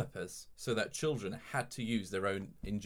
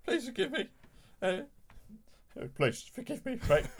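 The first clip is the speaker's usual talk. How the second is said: high, wobbly Michael Caine apology; 12 semitones higher than usual. Acted. Apologising to Frank Hornby, wobbly Michael Caine voice. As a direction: beg forgiveness as a trembling Michael Caine. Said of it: "your increasingly wobbly Michael Caine".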